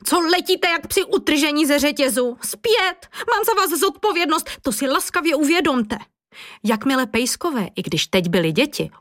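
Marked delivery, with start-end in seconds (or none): 0.0-6.0 teacher shouting. A narrator's reading. Teacher calling runaway children back, mildly acted shouting. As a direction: call them back with contained, high urgency. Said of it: "Paní učitelka křičela".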